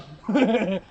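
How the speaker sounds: short laugh at his own mistake; rueful laugh. Real, not acted. Friends hunting story, a rueful laugh.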